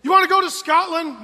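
high, loud eager sell; loud coaxing question, voice up high; excited pitch. Retold or imitated. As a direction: sell the trip to a toddler. Dad hyping toddler.

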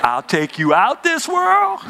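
hammed-up parent threat; mock threatening parent. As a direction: play the old-school parent's warning with a wink. Threatening parent saying, preacher mimics.

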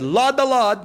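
high, loud hammed-up cry of praise; mock shout. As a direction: shout it like the concert guy would. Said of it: "he could have said".

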